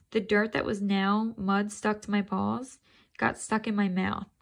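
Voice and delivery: plain reading voice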